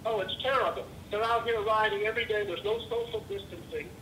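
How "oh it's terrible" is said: usual speaking voice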